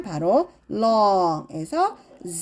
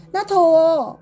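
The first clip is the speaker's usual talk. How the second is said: hot and bothered; 5 semitones higher, 6 dB louder, slower than usual. Shown as a demonstration. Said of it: "그럼".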